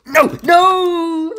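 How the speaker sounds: screaming no at the top of the voice; high shrieking no, no; panicked yell. Real, not acted. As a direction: cry out in real dismay. Sudden loss in game, yelling.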